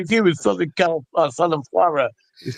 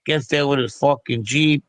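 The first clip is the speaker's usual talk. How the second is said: usual speaking voice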